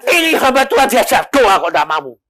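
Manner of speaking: mock indignant scolding, high-pitched; angry in-law. In-law angry at a refusal to pray, mock indignant. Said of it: "besan saya marah apa enggak? Marah".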